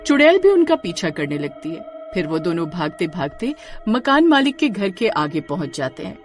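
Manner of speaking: ordinary delivery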